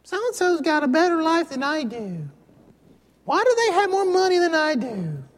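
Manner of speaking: put-on very high childish whine; adult whining. Adult envying others' money, childish whine. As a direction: grumble like a jealous kid. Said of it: "as adults, we whine too".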